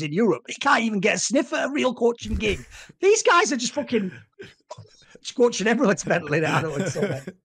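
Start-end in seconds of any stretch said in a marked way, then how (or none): none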